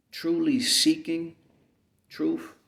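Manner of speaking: normal talking voice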